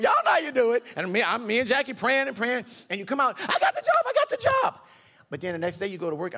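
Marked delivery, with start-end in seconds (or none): 3.4-4.8 high, loud triumphant cry of I got the job; overjoyed shout. Retold or imitated. Congregant telling the bishop the news, high joyful shout. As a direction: shout it out like you just got hired.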